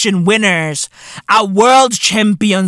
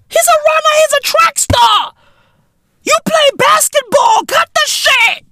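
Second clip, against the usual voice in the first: high insistent shout. Real, not acted. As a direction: insist it, yelling. Shouted insistence at Aaron Gordon.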